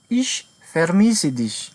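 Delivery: each word laid out slowly; very slow model sentence. Shown as a demonstration. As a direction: read it out slowly for beginners. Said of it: "model sentence for learners".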